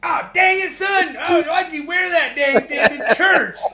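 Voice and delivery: an embarrassed father yelling at his son in church; exasperated dad. Retold or imitated. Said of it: "his dad's like".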